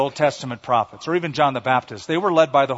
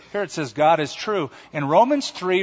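normal talking voice

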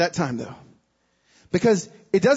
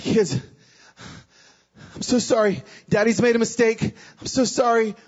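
put-on higher, pleading apology to the children; plead, upset, pushing the words out; distraught apology; 5 semitones higher than usual. Father confessing to his kids in tears, distraught.

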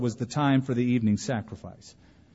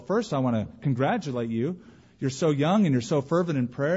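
ordinary talk, nothing marked

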